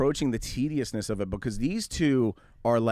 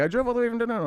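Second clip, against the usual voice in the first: much higher, chirpy put-on voice; mock broken English; 10 semitones higher, much faster than usual. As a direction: play the buyer eagerly typing back. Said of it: "And he's like".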